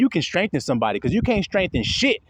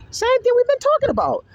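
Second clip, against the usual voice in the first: excited. Real, not acted. Preacher emphatic aside.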